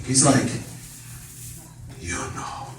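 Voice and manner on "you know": almost voiceless, slow murmur; hushed insinuation. Retold or imitated. Dad hinting to son at the unmentionable, near whisper. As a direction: barely breathe it, as if unmentionable. Dad avoids naming the thing.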